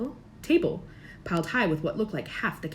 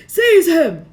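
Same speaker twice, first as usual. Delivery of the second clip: high, very loud scream; Voldemort ordering Quirrell; 10 semitones higher, 15 dB louder than usual. A narrator's reading. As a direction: bark the order with fury. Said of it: "Voldemort screamed".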